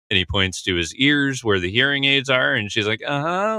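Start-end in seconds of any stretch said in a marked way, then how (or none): 3.0-3.6 high, smiling uh-huh; smiling plea. Retold or imitated. Shelley smiling pleadingly at Gordon, high uh-huh. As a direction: plead with a smile. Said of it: "smiling in a pleading way".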